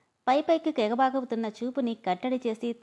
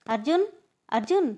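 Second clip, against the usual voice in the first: calling out; 4 semitones higher, 4 dB louder than usual. Acted. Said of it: "అని పిలుస్తున్న ... అరుపులు".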